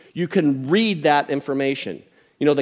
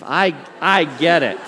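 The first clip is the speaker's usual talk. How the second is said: usual speaking voice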